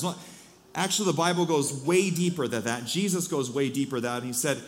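ordinary talk

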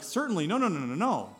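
mock protest. Peter refusing the voice's command.

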